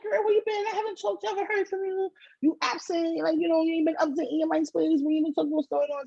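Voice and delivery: put-on high, lively girlfriend voice; bestie's voice. Bestie calling to check in, high and lively. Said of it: "She's like".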